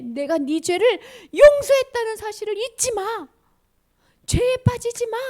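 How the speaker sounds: loud, high, insistent acted call; urgent exhortation. Jesus reminding you your sins are forgiven, urgent shout.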